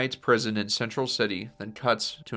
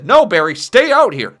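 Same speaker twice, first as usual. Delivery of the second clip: loud, high urgent yell; shouted warning; 9 semitones higher, 14 dB louder than usual. Acted. Henry to young Barry while running inside, shouted warning. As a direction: shout to keep the boy back.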